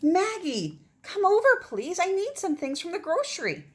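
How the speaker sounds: high, loud call over water; mother calling her daughter across the river, high and loud. Acted. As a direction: call out to Maggie far away. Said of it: "her mother called from across the river".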